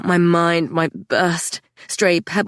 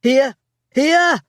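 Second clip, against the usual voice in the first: high calls getting higher; shouts; 7 semitones higher, 4 dB louder than usual. A narrator's reading. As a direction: call out to the diggers. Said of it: "I heard shouts of".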